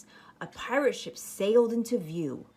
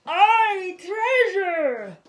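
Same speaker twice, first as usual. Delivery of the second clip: much higher, louder crew cheer; pirate crew shout; 12 semitones higher, 9 dB louder, much slower than usual. Acted. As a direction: roar it like greedy pirates. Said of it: "the others shouted".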